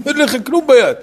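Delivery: very high, acted objection; exasperated payee. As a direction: protest in disbelief, pitch way up. Payee protests the empty hand.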